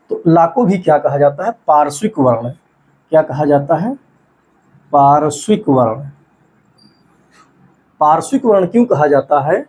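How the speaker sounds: normal talking voice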